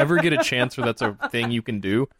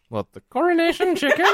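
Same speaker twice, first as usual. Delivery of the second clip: much higher, laughing British "coronation chicken"; mock British accent. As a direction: squeal it in a bad British accent through laughter. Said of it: "my horrible attempts at a British accent".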